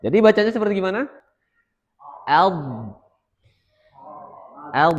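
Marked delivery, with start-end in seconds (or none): 2.3-4.9 sukun on the l, al; sukun demo. Shown as a demonstration. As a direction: say Al with a stopped, held l. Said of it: "seperti itu". (contoh sukun: Al)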